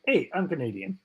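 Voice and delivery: teasing, exaggerated Canadian accent; hammed-up Canadian caricature; mock Canadian accent. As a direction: put on a jokey Canadian voice, vowels rounded into "hoose".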